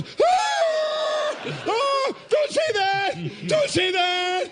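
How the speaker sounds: very high, loud panicked wail; mock crying scream. Storyteller acts out Rob hearing it's haunted, screaming in tearful panic. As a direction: shriek in hammed-up tearful terror. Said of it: "he starts crying".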